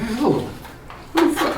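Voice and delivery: hushed, high-pitched disbelief; bewildered. Retold or imitated. Man baffled by girlfriend's accusation on the phone, hushed bewilderment.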